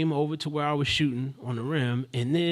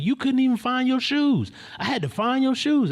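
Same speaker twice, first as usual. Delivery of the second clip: high, loud accusation; imitated confrontational rant; 11 semitones higher, 5 dB louder than usual. Man going on at young woman about shoes, mock confrontational. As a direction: go off on her, voice raised high. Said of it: "he started going on her".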